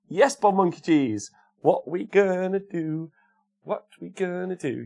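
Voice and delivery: high, drawn-out celebratory whoop; excited cheer. Real, not acted. Streamer celebrating a viewer's free day, excited cheer. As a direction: cheer them on, stretching the words.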